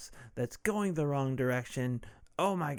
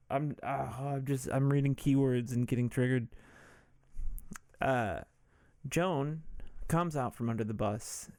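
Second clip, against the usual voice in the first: ordinary delivery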